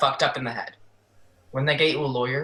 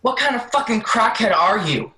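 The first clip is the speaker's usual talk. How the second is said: angry; 6 semitones higher than usual. Acted. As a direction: yell at her in disbelief. Guard bursting in.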